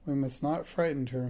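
ordinary delivery

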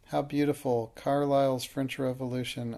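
plain reading voice

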